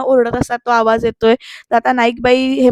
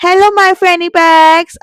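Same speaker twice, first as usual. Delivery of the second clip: high, loud, drawn-out vlogger greeting; vlog intro; 6 semitones higher, 6 dB louder, much slower than usual. Retold or imitated. Jayanti opening her vlog to viewers, exaggerated loud greeting. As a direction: stretch it out as a big vlog hello.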